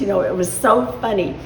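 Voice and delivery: normal talking voice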